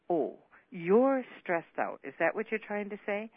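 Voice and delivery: mock his stress with a loud jeer; scornful outburst. Wife ridiculing husband about missed hearing, loud sarcasm.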